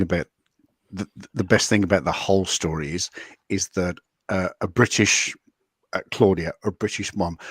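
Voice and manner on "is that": usual speaking voice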